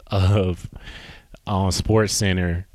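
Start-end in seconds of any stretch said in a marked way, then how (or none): none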